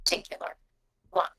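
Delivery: hushed gravelly official, half whispered. Acted. Game master voices the wasp allowing Roguefort a drink, gravelly voice. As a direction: set the limit in a quiet, raspy murmur. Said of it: "You hear the gravelly voice go".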